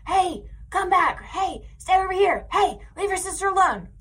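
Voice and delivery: high mock yell after the kids across the park; calling after kids. Mom at the park calling her wandering kids, high mock yell. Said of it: "I yell at them like".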